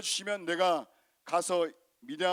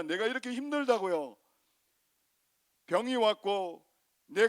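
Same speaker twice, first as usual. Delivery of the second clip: 높고 큰 소리로 하나님께 따지는 불평; 억울함을 쏟아내며 점점 높아지는 항의; 불평하듯 따지며; 3 semitones higher than usual. Acted. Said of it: "이렇게 하나님 앞에 불평까지 하는 겁니다". (하나님께 억울하게 따지며 높아지는 불평)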